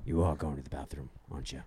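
very low, hushed creepy voice; Liam Neeson. Acted. Creeping on someone in the bathroom, low and quiet. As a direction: murmur it low, pausing between phrases. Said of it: "I'll whisper Liam Neeson in the door".

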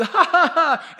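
high, loud mock laughter; hammed-up jeering cackle. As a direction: laugh at the king in scorn. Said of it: "laughing and giggling".

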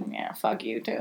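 very low, quiet retold comeback; muttered retort. Retelling her answer to her ex, muttered retort. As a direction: drop it to a low, resentful mutter.

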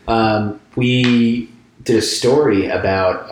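usual speaking voice

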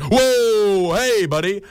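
high, drawn-out "whoa" of protest; alarmed call-out. Retold or imitated. Walkers calling to a cyclist passing too close, high protest. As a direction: call after someone, pitch leaping up.

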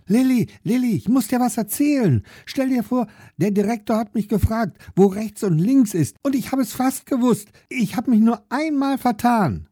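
put-on high, eager little-boy voice, words tumbling out; a small boy bursting with pride; proud little brother. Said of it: "sagt er stolz".

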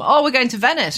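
high, loud, thrilled mock wife; excited quote. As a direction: gush about going to Venice. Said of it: "She got really excited".

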